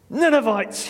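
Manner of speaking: high, loud, forced-cheerful call; falsely bright, high-pitched greeting. A narrator's reading. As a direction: force it out as cheerfully as you can. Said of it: "forcing the word out as cheerfully as he could".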